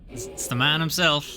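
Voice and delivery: usual speaking voice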